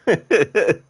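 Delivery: loud high burst of laughter. Real, not acted. Streamer laughing at surprising actor fact. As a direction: crack up out loud.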